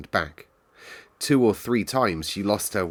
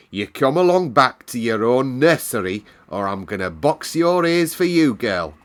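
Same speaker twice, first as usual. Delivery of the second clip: cross housekeeper; 4 semitones higher, 7 dB louder than usual. A narrator's reading. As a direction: shut the child down coldly. Housekeeper threatening Mary.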